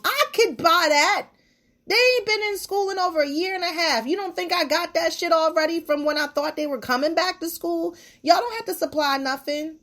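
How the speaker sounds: fed up and letting them have it; indignant rant. Real, not acted.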